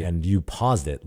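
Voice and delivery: normal talking voice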